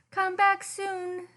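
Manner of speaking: high, slow, singsong goodbye; unicorn farewell. Acted. Unicorns bidding Kelp goodbye, high and slow. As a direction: send him off sweetly and slowly.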